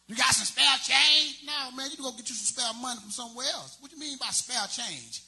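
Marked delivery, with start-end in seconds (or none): 0.1-1.4 slow, loud, pleading beggar call; drawn out begging shout at a passerby; mock crackhead. Crackhead begging the comedian for change, slow loud plea.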